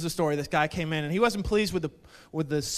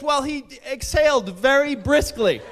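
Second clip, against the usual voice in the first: higher, louder, protesting defence; mock indignation; 8 semitones higher, 8 dB louder than usual. Puncher explaining why he hit a man, mock-indignant. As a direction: plead an absurd case emphatically.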